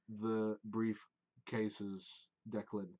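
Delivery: slow, cold elven voice; a hint of a chilly mage; cold elf. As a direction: draw it out like a quiet threat. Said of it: "the elven man ... go".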